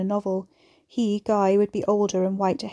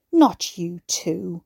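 slow, disappointed rasp; weary disappointment; 4 dB louder, much slower than usual. A narrator's reading. Beverly rebuking Guy as another rebel, raspy and disappointed. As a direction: rasp it, heavy with years of disappointment. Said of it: "loaded with years of disappointments".

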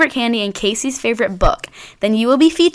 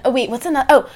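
ordinary delivery

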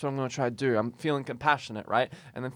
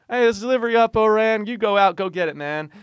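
higher, louder chirpy call; 9 semitones higher, 5 dB louder than usual. Retold or imitated. Coworkers chirping a delivery call, higher and louder. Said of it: "the beautiful tweets and chirps of".

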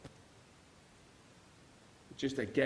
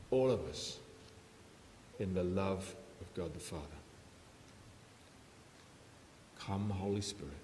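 quiet prayer; 2 semitones lower, 6 dB quieter than usual. Acted. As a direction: pray gently over the people, unhurried. Leads a closing prayer.

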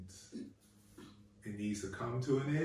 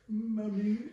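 put-on child calling Mommy; 9 semitones higher than usual. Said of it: "child say".

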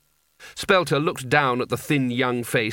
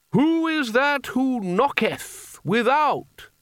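ceremonial call; 11 semitones higher, much slower than usual. A narrator's reading. Ritual question at the door.

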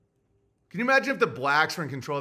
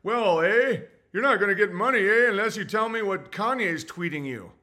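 higher, louder mock Canadian accent with the "eh"; 6 semitones higher, 5 dB louder than usual. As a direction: play a passive-aggressive Canadian banker, lightly. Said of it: "They'd be like".

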